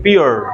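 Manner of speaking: "प्योर" as pyoo-er; British and American pronunciation. Shown as a demonstration. Teacher shows how native speakers say it, British and American pronunciation. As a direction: glide "प्योर" from u to schwa. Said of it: "American ऐसे बोलते हैं".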